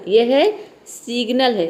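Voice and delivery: usual speaking voice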